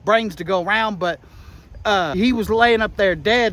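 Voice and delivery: ordinary delivery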